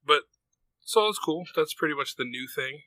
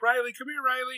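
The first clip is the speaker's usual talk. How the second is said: put-on higher, bright call to a child; teacher calling; 12 semitones higher than usual. Karate teacher calling the daughter over, higher sing-song call. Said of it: "one of the teachers, they're like".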